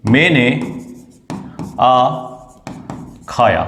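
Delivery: slow dictation. Shown as a demonstration. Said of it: "यदि मैं इसको ऐसे लिख दूं".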